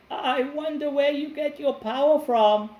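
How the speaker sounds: put-on high, loud old woman's voice; nosy old woman asking sweetly. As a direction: pitch it way up as the curious old woman.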